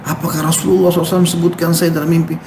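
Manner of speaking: a sinner weeping, asking the preacher; tearful question. Retold or imitated. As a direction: plead through tears, voice lifted. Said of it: "menangis terisak-isak".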